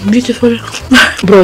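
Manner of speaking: usual speaking voice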